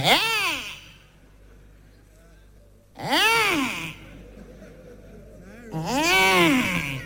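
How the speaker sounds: high, crying mock shouts of eh; Tinubu impression. Comedian mimics Tinubu calling him, crying out high. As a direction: wail like Tinubu on the line. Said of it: "When Tinubu called me".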